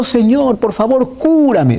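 high whiny plea for healing; pleading prayer. Retold or imitated. Preacher mocking the wrong prayer, high pleading. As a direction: ham up a begging sick man. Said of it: "No le digo".

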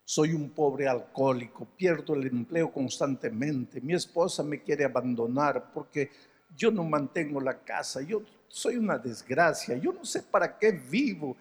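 imitating the sobbing man, understated; retold crying man. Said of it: "Y llorando me dice".

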